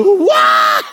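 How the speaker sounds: a high, loud shouted what with a laugh; stunned disbelief. Retold or imitated. Told he passed the counseling exam: laughing, shocked shout.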